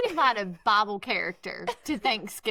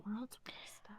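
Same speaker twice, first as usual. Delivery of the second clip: whispering; 20 dB quieter than usual. Real, not acted. Whispered aside.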